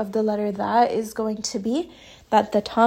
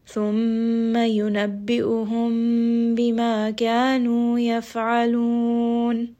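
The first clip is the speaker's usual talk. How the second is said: Quran recitation. Shown as a demonstration. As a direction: recite it melodically, drawing out the vowels.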